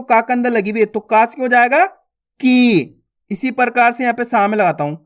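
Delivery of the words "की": "की" with a long, held ee; drawn-out vowel. Shown as a demonstration. Teacher showing the long vowel on का, drawn-out "की". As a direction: stretch the ee of "की". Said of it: "खींच खींच के उच्चारण करना है".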